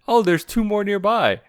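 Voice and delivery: high, loud acted exclamation; mock convenient discovery. Voicing Goku's convenient find.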